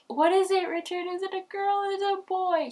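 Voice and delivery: high, eager, rushed asking; desperate to know the sex; eager questions. Retold or imitated. Mother asks her husband Richard, high and eager. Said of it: "And I was like".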